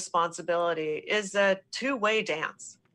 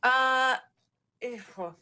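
sighing; 2 semitones higher, slower than usual. Real, not acted. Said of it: "Sandy just kinda sighs".

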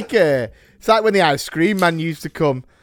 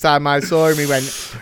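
ordinary talk

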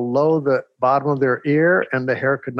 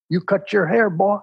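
imitating the principal giving orders, pitched up; bossy Texan principal; 7 semitones higher than usual. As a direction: play the coach who won't hear no. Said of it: "they talked like this".

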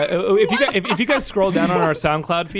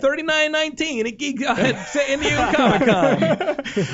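laughing promo; 9 semitones higher, slower than usual. Real, not acted.

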